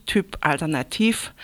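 usual speaking voice

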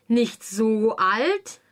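slow, drawn-out offended question; the Queen repeating his words, slowly offended. A narrator's reading. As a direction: let each word hang in disbelief.

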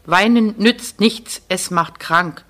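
usual speaking voice

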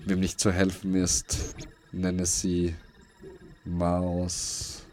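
ordinary talk, nothing marked

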